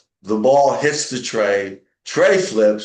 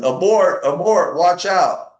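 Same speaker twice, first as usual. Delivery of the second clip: raised, higher mock yell; 6 semitones higher, 5 dB louder, slower than usual. Jimmy yelling a warning as the nachos slide, mock panic. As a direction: call it out in a hammed-up panic. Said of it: "starts yelling".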